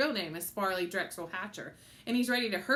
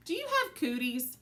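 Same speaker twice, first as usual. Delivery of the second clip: put-on child voice; 7 semitones higher than usual. A narrator's reading. Fudge asks waiter.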